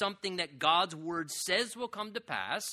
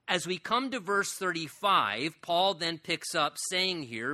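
ordinary talk

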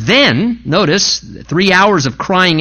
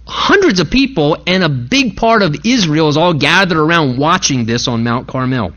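ordinary delivery

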